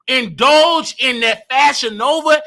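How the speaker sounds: normal talking voice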